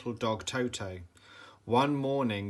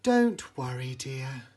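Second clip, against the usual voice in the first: kind tall lady; 3 dB louder than usual. A narrator's reading. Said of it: "said a tall lady".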